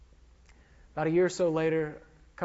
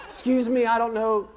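louder, higher mock plea; calling out for directions; 5 semitones higher, 6 dB louder, faster than usual. Lost pilot asking passers-by for the highway, calling out. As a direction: call out like a lost driver asking the way.

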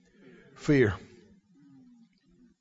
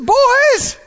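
high loud shout of cheer up; Jesus calling out; 13 semitones higher, 3 dB louder, slower than usual. Acted. Jesus shouting to the frightened boys in the boat, high and loud. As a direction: call out over the storm to comfort them. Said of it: "He said".

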